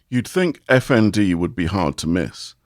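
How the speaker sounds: usual speaking voice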